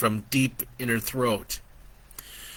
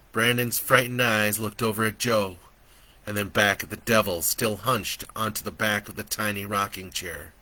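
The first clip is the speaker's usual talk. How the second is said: ordinary delivery, nothing marked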